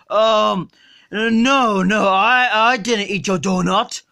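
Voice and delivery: put-on sluggish, drunk and lazy; Homer Simpson. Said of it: "Look, Homer".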